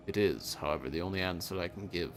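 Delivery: solemn, low and soft grim reaper; a hint of an ancient hooded figure. Voices the Reaper reading.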